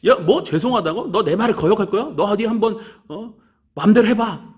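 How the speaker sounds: acted-out exasperated telling-off; angry teacher. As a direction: imitate a teacher indignantly calling after a student. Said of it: "선생님이 막 뒤에서 화내시더라고요".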